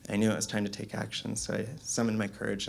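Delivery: usual speaking voice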